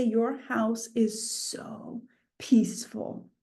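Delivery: soft, slow, breath-light quote; hushed awe. Retold or imitated. Visitors marvel at the home's calm, in a slow hush. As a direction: imitate an awed guest, nearly whispering.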